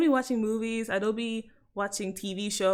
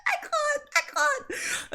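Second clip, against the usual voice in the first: very high, squeaked through laughter; helpless laughter; slower than usual. Real, not acted. Londoner giving up on an accent, helpless high laughter. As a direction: barely get it out for laughing.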